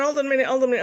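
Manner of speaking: high, loud retold protest; urgent calling out. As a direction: call after them to stop them leaving. Retells stopping crew leaving with husband.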